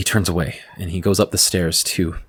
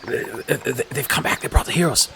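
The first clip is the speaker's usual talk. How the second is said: hushed guards' excited whisper; soft, breathy stage whisper; 3 semitones higher, 5 dB quieter than usual. Acted. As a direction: whisper in stammering amazement, pitch rising at the end. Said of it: "are whispering to each other".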